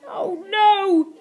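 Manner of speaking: high, loud cry of dismay; acted alarm from Slime; exclaiming. A narrator's reading. Slime cornered by cats, exclaiming in dismay. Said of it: "exclaimed Slime".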